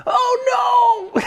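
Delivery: high mock cry of dismay; dismayed brides. Dracula's brides seeing the monster fall, a high mock cry. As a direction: squeal it like a horrified bride. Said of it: "they're like".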